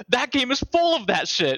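high-pitched mock accusation. Mimicking critics' accusation on podcast.

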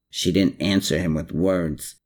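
plain reading voice

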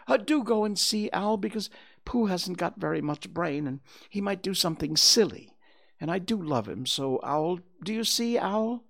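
put-on high-pitched, earnest boy character; worried storybook child voice; pleading, concerned boy. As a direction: plead with Owl for a favour.

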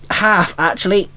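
ordinary delivery, nothing marked

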